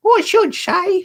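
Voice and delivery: put-on cheeky high boy teasing; high and mocking, a young rascal; high boyish Charlie Bates. Charlie Bates mocking the Dodger, high cheeky boy.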